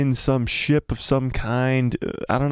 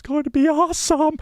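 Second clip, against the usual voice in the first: very high sung-out squeal; giddy high-pitched hype; over-hyped gushing. Pretend. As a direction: soar up into falsetto and stretch the last word. Hyped sing-out about the movie.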